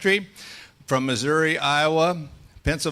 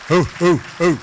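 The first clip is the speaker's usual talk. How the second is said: whooping cheer; 4 semitones higher, 9 dB louder than usual. Real, not acted. Cheering introduction of Vicki.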